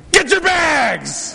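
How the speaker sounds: high, slow shouted command; yell. Retold or imitated. Yelling at his young adults to grab their bags. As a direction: bellow it across the tarmac. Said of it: "And I yell at my Presbyterian young adults".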